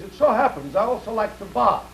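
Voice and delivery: put-on Russian-accented punchline; Russian wolfhound. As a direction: answer in a mock Russian accent. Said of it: "And the Russian dog says".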